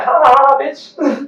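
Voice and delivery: high put-on ha-ha, tossed-off insult; high-pitched teasing cackle in imitation; mock taunting laugh. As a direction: fake a laugh, then drop the volume. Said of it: "try to trigger you and laugh".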